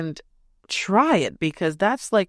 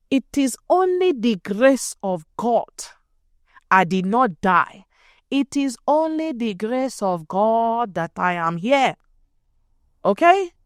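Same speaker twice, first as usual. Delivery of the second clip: put-on Nigerian accent; 4 semitones higher than usual. Telling the audience in her Nigerian accent she survived only by God's grace. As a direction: thank God in a Nigerian accent. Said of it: "let me use my, my Nigerian accent".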